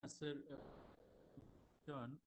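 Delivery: very faint, far from the mic; speaking far off the mic, faint; barely audible. Real, not acted. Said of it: "your voice is very faint".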